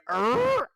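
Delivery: loud, high imitated door creak; creaking door noise. Caller recreating the door opening mid-match, high imitated creak. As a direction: make the creak of a door swinging open. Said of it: "He creaks the door".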